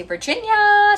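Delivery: high, loud, drawn-out name; playful soaring shout; exuberant call-out. Real, not acted. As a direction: sing out the name like a cheer. Calls out a viewer's name.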